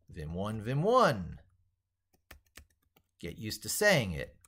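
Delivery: ordinary talk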